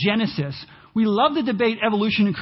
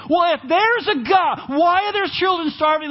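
higher, indignant challenge; mock accusing skeptic; 7 semitones higher, 3 dB louder than usual. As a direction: throw the question like an accusation. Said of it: "people come to you and say".

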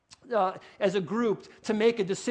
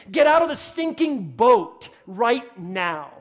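loud, slow, punched-out order; forceful command; 7 dB louder, slower than usual. Acted. Jesus ordering a hesitant follower out of the boat, forceful command. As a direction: order it loudly, spacing out the words.

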